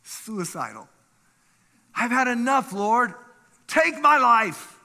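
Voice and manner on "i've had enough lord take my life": high, slow, anguished cry to God; begging the Lord to end it; despairing plea. Acted. Elijah under the broom bush, despairing cry.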